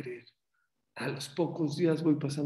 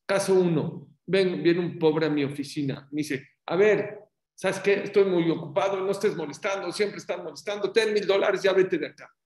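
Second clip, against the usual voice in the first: loud, higher, brusque brush-off; impatient giver; 5 semitones higher, 5 dB louder than usual. Retold or imitated. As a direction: shoo the poor man away. Voicing a rude, impatient giver. (un hombre impaciente corre al pobre en voz alta)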